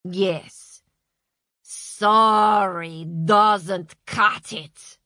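slow, cold and loud; snarled. A narrator's reading. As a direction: snap it back. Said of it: "snarled Masha".

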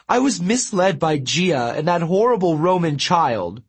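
a vain sulky god making excuses; dramatic god Apollo. A narrator's reading. Apollo pleads his case.

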